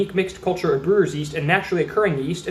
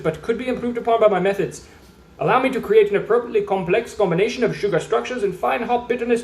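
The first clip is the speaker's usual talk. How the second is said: higher, hammed-up German-ish accent, formal and grand; mock German accent; 4 semitones higher than usual. Reading the beer label aloud as its character, mock German accent. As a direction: play a pompous foreign brewer in a drifting German accent. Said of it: "I don't know what this accent is. It started as German".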